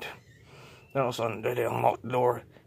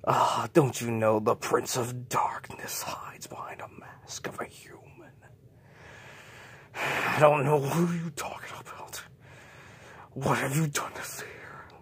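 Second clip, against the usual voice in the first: spooky whisper; 2 semitones higher than usual. Acted. Spooky confrontation in darkness.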